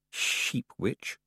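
breathless whispered jab; whispered taunt. Acted. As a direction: whisper it like a sly tease.

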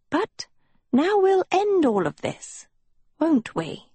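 high sly witch voice. A narrator's reading. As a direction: purr it like a scheming witch. Sophie as a witch.

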